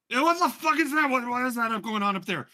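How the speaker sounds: loud, high-pitched alarmed outburst; high, loud, startled mother; Bulbug's mother. Acted. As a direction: shout it up at the sky, demanding to know. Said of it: "Bulbug's mother, uh, just going, uh, just goes".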